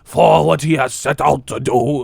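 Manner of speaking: hammed-up villain-style declaration; mock Wolfenstein voice. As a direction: declare it like a stern old officer. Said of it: "shut your Wolfenstein ass up".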